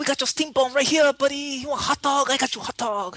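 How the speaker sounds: put-on high-pitched caricatured Chinese-accented vendor; hammed-up Hong Kong accent sales patter; bad Jackie Chan. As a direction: hawk food in an exaggerated fake Chinese accent. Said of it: "a bad Jackie Chan".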